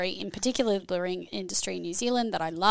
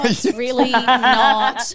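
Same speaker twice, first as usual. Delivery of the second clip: laughing; 3 semitones higher, 9 dB louder, much slower than usual. Real, not acted. Laughing while scolding the co-host.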